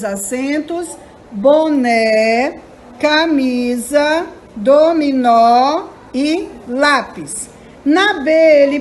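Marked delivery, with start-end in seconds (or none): 1.3-2.6 "boné" drawn out as bo-né, rising; syllable by syllable. Shown as a demonstration. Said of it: "separando em sílabas". 3.0-7.5 "camisa, dominó e lápis" slowly split into syllables. Shown as a demonstration. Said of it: "separando em sílabas".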